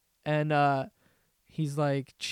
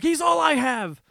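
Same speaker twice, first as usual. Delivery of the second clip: high, loud desperate wail; desperate cry; 10 semitones higher, 6 dB louder than usual. Retold or imitated. Boy begging the gunman to spare his friend, loud desperate wail. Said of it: "He's like".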